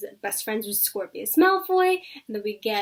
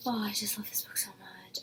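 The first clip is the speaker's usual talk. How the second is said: breathy whispered gush; hushed adoring whisper; 10 dB quieter than usual. Real, not acted. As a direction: whisper it like a secret love confession. Gushing over a beloved book.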